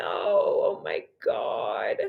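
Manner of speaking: choked, whispered through crying; barely gets it out, overcome with tears; tearful whisper on the verge of crying; real tears. Real, not acted. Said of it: "you're gonna make me cry".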